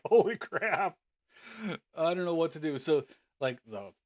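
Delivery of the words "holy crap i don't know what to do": high, loud, laughing through the words; laughing panic. Retold or imitated. Retelling the fish chaos to viewers, laughing loudly. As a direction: giggle helplessly at the chaos.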